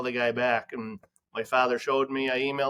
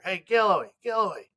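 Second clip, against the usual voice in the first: calling out; 8 semitones higher than usual. Retold or imitated. Someone calls across emergency room.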